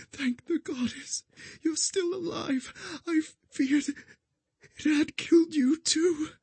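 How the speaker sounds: high, tearful dying voice; bleeding out. A narrator's reading. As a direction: cry weakly with relief. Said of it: "dying woman, tearful relief".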